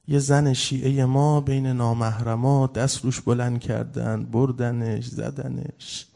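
slow, drawn-out preacher's lament; mourning sermon. Acted. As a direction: build the grief toward a loud, heavy climax.

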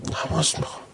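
whisper. Shown as a demonstration. Preacher echoing his own cry to the congregation, whispered. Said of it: "loud take before it".

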